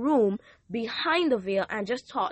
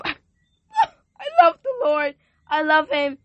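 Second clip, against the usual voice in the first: a laughing, slow cry of love for the Lord, much higher and louder; ecstatic praise; 5 semitones higher, 8 dB louder, slower than usual. Real, not acted. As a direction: let joy break through the words.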